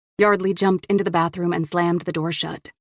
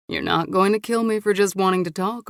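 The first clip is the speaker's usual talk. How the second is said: usual speaking voice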